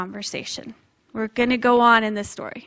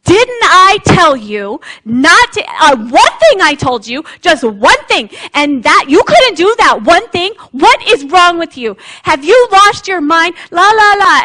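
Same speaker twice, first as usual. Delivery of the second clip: exasperated parent yelling; 4 semitones higher, 9 dB louder than usual. Pretend. A parent yelling at children who broke the one rule. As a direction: blow up like a fed-up parent. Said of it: "He does not start yelling and screaming".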